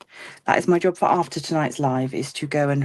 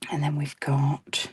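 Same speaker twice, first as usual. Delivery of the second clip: whispering; 3 semitones lower, 4 dB quieter than usual. Real, not acted. Crafting, whispered aside.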